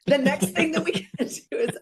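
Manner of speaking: bursting into relieved laughter while talking; laughing through words. Real, not acted. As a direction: giggle through the line after getting emotional. Laughing after tearing up.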